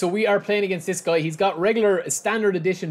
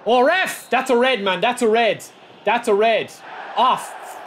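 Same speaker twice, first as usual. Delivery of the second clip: loud, high outraged protest; angry protest; 6 semitones higher, 6 dB louder, slower than usual. Real, not acted. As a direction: demand the red card from the ref. Protesting foul to referee.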